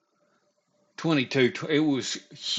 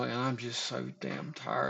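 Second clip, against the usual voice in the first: normal talking voice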